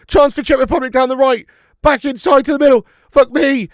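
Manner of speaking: loud, high rushed play-by-play; excited commentary. Real, not acted. Streamer catches up on goal, excited loud commentary.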